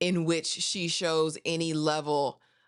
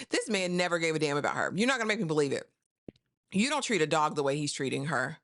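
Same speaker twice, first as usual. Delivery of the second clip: usual speaking voice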